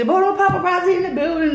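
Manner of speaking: high hype shout-out; excited announcer. Retold or imitated. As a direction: call it out high and fast. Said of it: "they usually".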